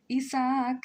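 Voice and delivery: Isaac said as ee-sahk with Spanish vowels; Spanish-style Isaac. Real, not acted. Streamer addressing character Isaac, Spanish ee-sahk pronunciation. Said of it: "I love her pronunciation".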